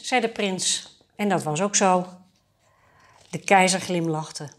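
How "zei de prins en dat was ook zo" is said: ordinary delivery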